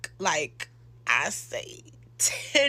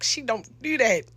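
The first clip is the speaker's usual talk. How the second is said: usual speaking voice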